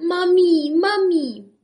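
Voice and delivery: high, loud wailing; crying for mommy. A narrator's reading. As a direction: wail for mother. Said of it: "ječel". (plačtivé volání)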